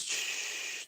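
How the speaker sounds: breathy unvoiced shush; hushing. Darth hushing Luke at breakfast, whispered shush. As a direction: shush someone gently. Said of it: "And he goes".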